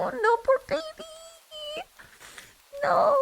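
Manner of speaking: very high, squeaky pitying wail; crying for poor baby. Retold or imitated. Streamer re-enacting their reaction to the meows, high tearful wail. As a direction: wail in pity for a baby animal. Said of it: "and I'm crying. I was like".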